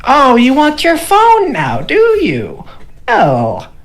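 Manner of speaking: high, slow teasing gnome voice. Acted. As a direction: taunt like a smug little gnome. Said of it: "but he's like".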